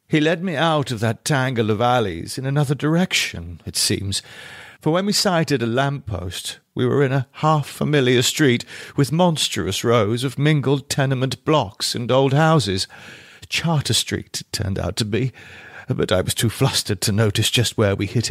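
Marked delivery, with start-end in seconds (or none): none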